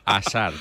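"Hazard" as "a-SAR", h left out; silent H. Shown as a demonstration. Correcting the cohost, Hazard with silent H. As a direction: say "Hazard" from a clean opening a, no d. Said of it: "Ahí te corrijo".

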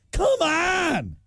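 high, drawn-out cry of exasperation; exasperated shout. Real, not acted. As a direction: throw it out in real frustration, pitch way up. Ranting about the forgotten item.

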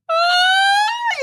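high wailing sob burst; ugly cry wail. Retold or imitated. To her husband on the phone, a high ugly-cry wail. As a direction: let out a high broken cry. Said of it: "that bending down crying thing".

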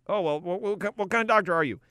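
high, fast, stammering doctor voice; flustered physician. Acted. Physician questioning Doctor Ernst on phone, high flustered stammer. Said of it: "phone calls with physicians".

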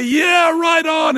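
high, drawn-out mock cheer; loud stretched shout of hearty approval, imitating a crowd; imitated cheer. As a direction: call out like an approving passerby. Crowd cheering the sign in retelling.

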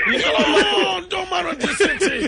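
high, slow excited yell; excited shout. Real, not acted. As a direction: cry it out with delight. Host exclaims after long pause.

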